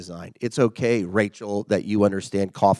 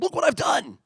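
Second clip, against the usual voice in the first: much higher, thrilled squeal; excited colleague. Retold or imitated. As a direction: show off your work like a giddy coworker. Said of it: "she's so excited".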